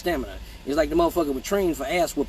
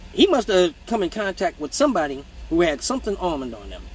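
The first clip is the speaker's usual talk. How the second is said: ordinary talk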